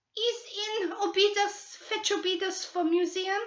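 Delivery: put-on high old woman with a Portuguese accent; Portuguese-accented old woman refusing flatly; Brazilian housekeeper. Old woman stonewalling the detectives in a Portuguese accent.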